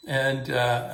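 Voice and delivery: normal talking voice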